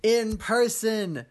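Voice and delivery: big, slow hype-up intro; excited announcer. Real, not acted. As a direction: announce it like a game show. Podcast host hyped intro.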